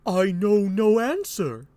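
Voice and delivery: high, slow courtly reply; Burgundy. Acted. Burgundy replying to the king, high slow voice. Acts Burgundy answering Lear.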